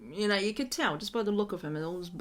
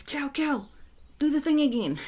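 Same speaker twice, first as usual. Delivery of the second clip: higher-pitched imitation of the wife; urgent wife; 7 semitones higher, 4 dB louder than usual. Wife asking Cal to repeat the healing, urgent. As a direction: call out excitedly, as the wife.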